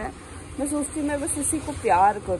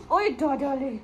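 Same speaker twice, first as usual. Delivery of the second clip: cooing call to dog; 4 semitones higher, slower than usual. Real, not acted. Calling dog in kitchen.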